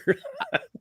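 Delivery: sudden high-pitched laugh; high burst of laughter. Real, not acted. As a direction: let out a squeaky laugh. Reacts to Outrun remark.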